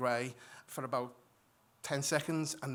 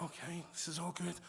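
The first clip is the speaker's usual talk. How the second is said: soft relieved hush; 10 dB quieter than usual. Retold or imitated. Calming down after birth.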